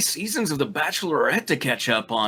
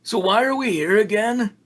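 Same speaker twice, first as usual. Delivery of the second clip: higher-pitched, mildly raised call; 10 semitones higher than usual. Acted. Damien calling to the group while searching, mild shout. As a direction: call it out across the room. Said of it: "Damien shouted as they moved around".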